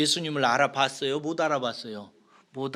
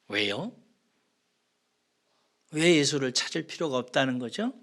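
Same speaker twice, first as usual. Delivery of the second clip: ordinary delivery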